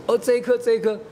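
high-pitched imitation of a little girl; eager child. Daughter showing father which tooth, insistent child.

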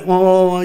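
a high, loud mock police siren wail; playful wah-wah siren sound; siren imitation. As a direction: sing out the siren of a passing police car.

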